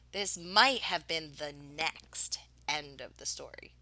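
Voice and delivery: plain reading voice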